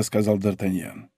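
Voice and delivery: ordinary delivery, nothing marked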